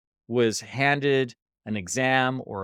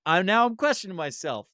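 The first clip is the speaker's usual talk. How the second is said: high-pitched, lightly acted unsure student; mock a student doubting themselves, pitch climbing; a hint of self-doubting student, voice pitched up; hesitant student; 6 semitones higher, 4 dB louder than usual. Unsure student questions a test answer.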